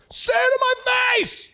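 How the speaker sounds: high, loud imitated holler; mock fighting shout. Little kids answering "I love you" on video, mock shout. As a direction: yell it back as a playful challenge.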